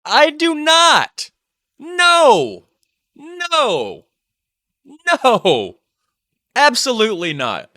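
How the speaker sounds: high, loud, drawn-out protest; theatrical high-pitched objection; emphatic refusal. Acted. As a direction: hold each word high in outraged denial. Co-host rejects praise of Biden.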